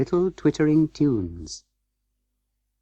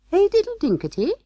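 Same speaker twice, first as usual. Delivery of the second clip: little mice; faster than usual. A narrator's reading. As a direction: answer as tiny singsong mice. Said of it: "answered the little mice".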